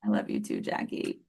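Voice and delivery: soft unvoiced whisper. Real, not acted. Guest whispering love back to tearful host. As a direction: breathe it tenderly. Tender reply after tearful moment.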